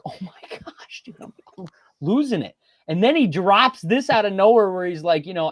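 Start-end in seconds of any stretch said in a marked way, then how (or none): 0.0-2.6 panicked whisper. Retold or imitated. Panicked aside before going live.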